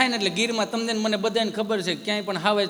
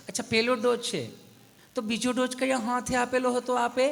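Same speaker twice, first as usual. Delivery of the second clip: polite nurse; 5 semitones higher than usual. Retold or imitated. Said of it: "નર્સબેને એટલું વિવેકથી પૂછ્યું".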